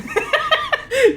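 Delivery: high, cackling burst of laughter. Real, not acted. Streamer reading a Russian comment, bursting into high laughter. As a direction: crack up at the message.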